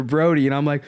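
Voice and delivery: ordinary talk, nothing marked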